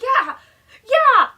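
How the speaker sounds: high, loud, pumped-up yeah; fired-up yeah. Retold or imitated. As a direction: shout it like you're all in. Chip agreeing, worked up.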